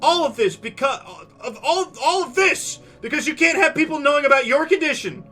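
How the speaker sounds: high, loud tearful tirade; yelling. Acted. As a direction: scream it across the whole room. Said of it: "She starts yelling".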